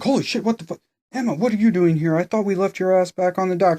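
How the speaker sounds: high, loud, fast disbelief; a hammed-up friend caught off guard; shocked mock Audrey. Mocking Audrey finding Emma on the island. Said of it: "And Audrey's like".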